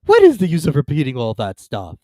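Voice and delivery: loud, exasperated cut-in; a loud, impatient turtle; interrupting Mock Turtle. A narrator's reading. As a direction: cut her off. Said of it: "the Mock Turtle interrupted".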